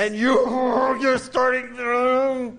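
very high, loud, drawn-out mock fury; hissing rage demo. Shown as a demonstration. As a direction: act out boiling over, stretching the words. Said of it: "fängt's an zu zischen". (übertrieben wütend aufbrausen)

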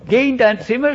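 ordinary delivery

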